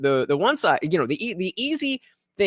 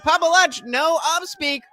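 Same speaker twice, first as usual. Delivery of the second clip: reading a donor's message; 11 semitones higher than usual. Retold or imitated. Streamer reading a paid chat message aloud, pitched up. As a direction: relay the viewer's jab with a grin.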